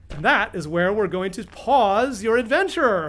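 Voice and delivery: normal talking voice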